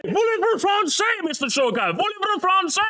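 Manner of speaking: high, hammed-up French accent; mock French accent. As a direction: tease in a cartoon French accent. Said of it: "at least try".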